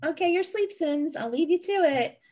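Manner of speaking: high cooing owner voice; a owner tucking in her sleepy dog, high and sweet. Retold or imitated. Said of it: "And I'm like".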